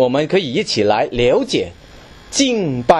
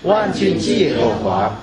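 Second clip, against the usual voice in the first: scripture reading; 2 semitones higher than usual. Acted. Reading Isaiah 6 aloud.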